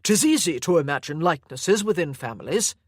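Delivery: a hint of a doting, controlling grandfather; obsessive old grandfather. Sir Lovett speaks to Anna.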